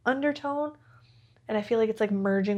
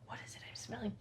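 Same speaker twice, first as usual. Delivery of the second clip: whisper; 16 dB quieter, much faster than usual. Real, not acted. Sniffing perfume, whispering.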